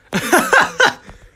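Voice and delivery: high, loud burst of laughter. Real, not acted. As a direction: cackle at your own joke. Laughs at own office greeting.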